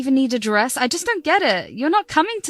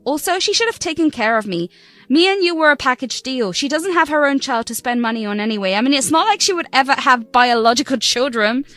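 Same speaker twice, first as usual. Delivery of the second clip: defiant shout. Acted. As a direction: throw it back in his face. Daughter shouting back at father.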